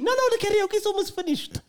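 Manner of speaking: fast, high, rushing the words out; brushing off the worry in a rush; hurried insistence. Retold or imitated. Venue staff rushing to reassure the comedians, hurried and high.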